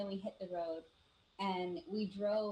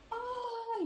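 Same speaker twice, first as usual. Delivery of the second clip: high-pitched startled cry, held out; startled yell; 3 dB louder than usual. Retold or imitated. Driver crying out as something appears on the road, startled yell. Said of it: "he, like, yelled".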